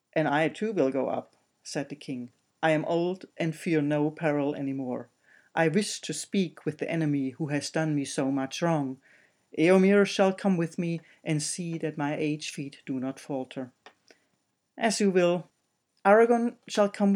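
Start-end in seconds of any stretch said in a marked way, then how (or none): 0.1-1.4 put-on old king voice. 2.6-13.7 put-on old king voice.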